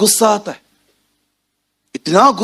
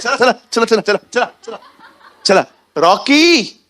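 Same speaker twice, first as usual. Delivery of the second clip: mock insistent call; 6 semitones higher, slower than usual. Mother calling repeatedly.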